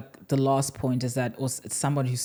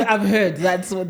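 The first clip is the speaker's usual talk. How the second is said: ordinary talk, nothing marked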